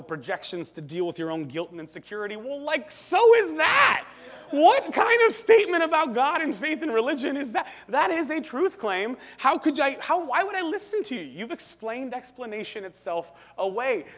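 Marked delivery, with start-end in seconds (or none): 2.4-11.2 high, shouted rebuttal; incredulous outburst. Real, not acted. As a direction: fire exasperated questions loudly at an absent opponent. Preacher's incredulous rebuttal of Freud.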